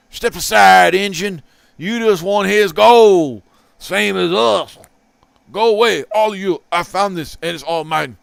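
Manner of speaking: hammed-up cowboy villain voices; gruff outlaws. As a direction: act out the speech balloons like a villain. Reading the comic's speech balloons aloud.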